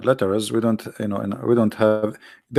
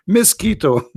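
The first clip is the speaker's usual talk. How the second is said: higher, blurted-out mosquito; retold exclamation; 8 semitones higher, slower than usual. Speaker retelling his slip while introducing ladies, exclaimed. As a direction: blurt it out as in the story. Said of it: "I said".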